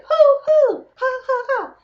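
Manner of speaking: high throat laugh, hoo hoo ha; light throaty hoo hoo ha laugh. Shown as a demonstration. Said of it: "less of my throat".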